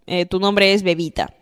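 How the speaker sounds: ordinary talk, nothing marked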